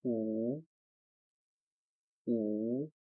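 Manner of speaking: "E" said clearly for learners; Chinese word model. Shown as a demonstration. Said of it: "The second one is".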